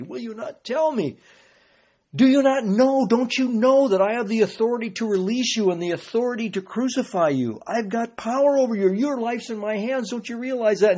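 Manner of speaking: acted exasperation, pushing hard for an answer; insistent demand. Pilate confronting a silent Jesus, insistent and exasperated.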